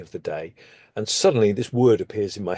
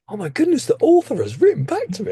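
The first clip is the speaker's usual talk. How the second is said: much higher, louder excited gasp of delight; high, louder, giddy retold excitement; thrilled disbelief; 5 dB louder, faster than usual. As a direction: squeal it out like a fan who just heard back. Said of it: "I was like".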